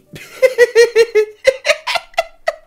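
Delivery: loud, very high laughing burst; hysterical laugh. Real, not acted. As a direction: lose it completely. Cracks up at the graphic.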